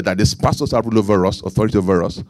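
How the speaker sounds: ordinary talk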